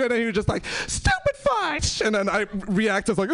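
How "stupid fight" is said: put-on high, drawn-out child's battle cry; imitated kid spellcasting. Pupil firing a spell at his teacher, high drawn-out cry. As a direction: cast a spell in a squeaky kid voice.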